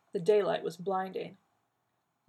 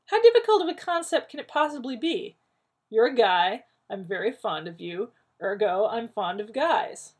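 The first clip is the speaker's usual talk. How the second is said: exasperated; 4 semitones higher, 10 dB louder than usual. A narrator's reading. Rodney exasperated rant.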